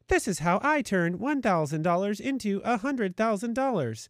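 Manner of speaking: high, hammed-up robot voice; mock a clickbait robot voice. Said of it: "the robot voice".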